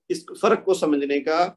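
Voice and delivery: ordinary talk, nothing marked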